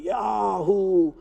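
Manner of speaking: drawn-out, soft mock "yahoo"; retold happy cheer. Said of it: "he's coming happily".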